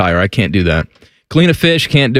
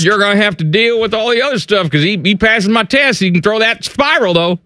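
higher, offhand mock dad defending the guy; 8 semitones higher than usual. Father defending the daughter's boyfriend, higher mock voice. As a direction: shrug it off like a proud dad.

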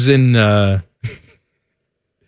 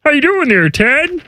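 high strained puppet voice; put-on squeezed high voice; Kermit; 6 semitones higher than usual. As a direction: greet her like a frog puppet.

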